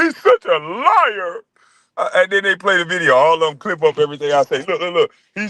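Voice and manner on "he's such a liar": higher, slow, drawn-out taunt; hammed-up wounded whine; mocking whiny impression. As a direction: mimic a hurt whiner, stretching the words. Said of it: "He talking about".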